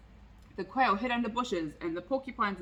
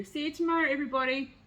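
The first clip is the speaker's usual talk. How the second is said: higher squirrel calling down from a tree; 5 semitones higher, faster than usual. A narrator's reading. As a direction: call out a cheery goodbye. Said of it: "called out from a pine tree".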